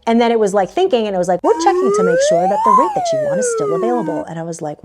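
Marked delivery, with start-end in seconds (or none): 1.4-4.2 mock website voice, much higher, like a chirpy robot; website message voice. Booking website telling the traveler it checks the rate, in a high chirpy mock voice. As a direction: play the booking site's cheerful automated notice.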